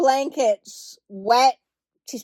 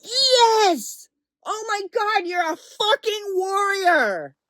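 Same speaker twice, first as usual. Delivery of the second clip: excited cheer; 7 semitones higher, slower than usual. Retold or imitated. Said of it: "I'm like".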